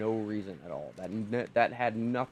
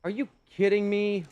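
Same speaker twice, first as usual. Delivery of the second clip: high, louder disbelieving cry; exasperated; 9 semitones higher, 5 dB louder than usual. Real, not acted. Gamer cries out again at the drowned dino, exasperated. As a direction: throw it at the screen.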